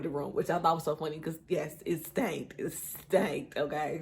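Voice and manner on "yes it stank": usual speaking voice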